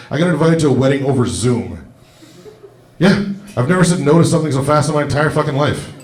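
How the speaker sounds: ordinary talk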